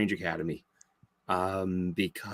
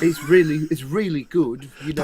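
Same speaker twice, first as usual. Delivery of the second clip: put-on English accent; higher opening in a mock English accent; 4 semitones higher, 4 dB louder than usual. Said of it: "I like that accent".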